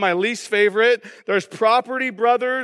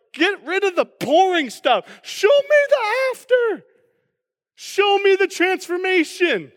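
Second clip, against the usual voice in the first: much higher, half-shouted demand to skip ahead; impatient shout; 9 semitones higher than usual. Retold or imitated. Pastor playing an impatient viewer, shouting at the TV. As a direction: yell at the TV to get to the reveal. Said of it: "And it's like".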